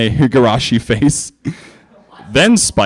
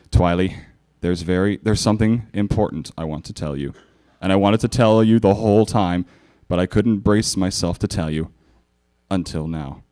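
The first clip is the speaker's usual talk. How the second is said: lower, softer, earnest; tender confession; 5 semitones lower, 5 dB quieter than usual. Acted. Spike confessing to Twilight, soft and earnest. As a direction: confide it gently like a secret. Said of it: "looking deeply into her eyes".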